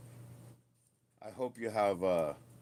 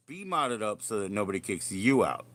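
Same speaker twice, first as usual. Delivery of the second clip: usual speaking voice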